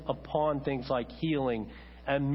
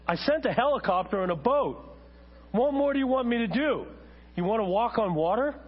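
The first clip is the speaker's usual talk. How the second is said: high, incredulous rebuke; exasperated God; 6 semitones higher, 3 dB louder than usual. Acted. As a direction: voice God losing patience with the man. God's exasperated punch line, acted.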